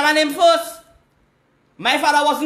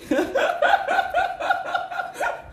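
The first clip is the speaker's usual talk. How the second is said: a burst of high laughter; gleeful cackle; high cackling laugh; 4 dB quieter than usual. Real, not acted. Caller laughs about the money.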